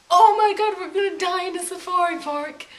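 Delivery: screaming in fear of the ostrich; panicked shout. Retold or imitated. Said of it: "And we're like".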